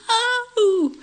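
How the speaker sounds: very high cartoon-boy refusal; high mock wail of protest; protesting child. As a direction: play a little boy resisting being shoved out.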